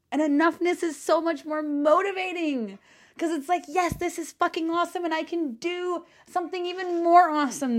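high, eager and fast; passionate excitement. Real, not acted. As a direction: gush about it like a revelation. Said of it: "sometimes I feel like I'm screaming".

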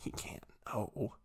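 hushed, slow, breathy inner panic; panicked whisper. Retold or imitated. Storyteller reliving his panic, whispered slowly. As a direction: whisper it slowly to yourself in dread.

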